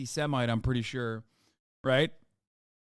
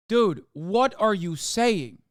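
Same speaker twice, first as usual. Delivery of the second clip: slow disbelief; 2 semitones higher, much slower than usual. Real, not acted. Incredulous reaction to tweet.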